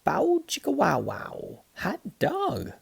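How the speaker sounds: high, thrilled wow-wow guitar sounds; gleeful high-pitched guitar imitation; excited wow. A narrator's reading. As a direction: burst out in delight at the new sound. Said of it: "Les said".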